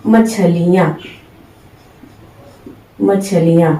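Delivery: मछलियां said softly, ma-chha-li-yaan; quiet careful मछलियां; soft dictation of मछलियां; softly modelled word. Shown as a demonstration. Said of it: "तो क्या लिखेंगे हम".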